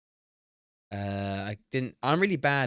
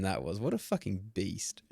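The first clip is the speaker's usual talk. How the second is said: ordinary delivery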